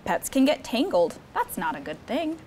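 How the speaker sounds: usual speaking voice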